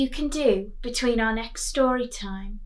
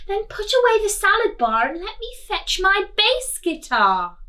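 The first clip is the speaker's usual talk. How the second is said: higher, louder cheerful monster; Dave the monster; 10 semitones higher, 6 dB louder than usual. Acted. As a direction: invite them to the party. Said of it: "he said".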